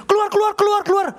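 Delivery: high, rapid-fire angry ordering out; imitated raging. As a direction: act out a furious healer throwing people out. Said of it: "Waktu dia ngamuk, dia bilang gini". (menirukan orang ngamuk mengusir, nada melengking tinggi)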